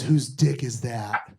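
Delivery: slowly drawn out, low and puzzled; wife's question. Retold or imitated. Wife asking mid-sex, slow low puzzled question. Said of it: "and then she goes".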